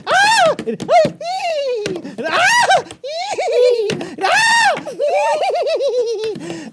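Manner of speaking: very high hammed-up shrieks; panicked screams. Uncle acting Moses trapped at the Red Sea, mock shrieks.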